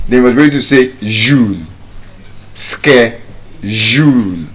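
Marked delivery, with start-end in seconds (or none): 2.5-4.5 "Schedule" very slow as "skejool"; correct skejule. Shown as a demonstration. Teacher insists on the correct ske- form. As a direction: stretch out the correct American "Schedule". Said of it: "if you are saying ske".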